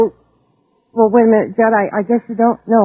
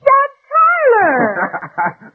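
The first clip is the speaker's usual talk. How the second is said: surprised recognition; 12 semitones higher, much slower than usual. Acted. Surprised recognition of old flame.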